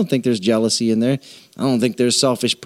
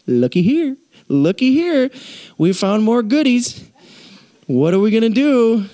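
higher, nosy, gleeful voice for the Bible; Bible as busybody; 8 semitones higher than usual. Acted. Preacher voicing the Bible digging up sins, teasing. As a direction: poke around like a snoop finding treasures. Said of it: "the Bible doesn't mind if it offends me and says".

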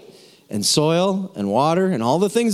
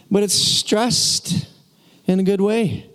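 mock Irish accent; 4 semitones higher, slower than usual. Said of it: "why stress is Irish right now".

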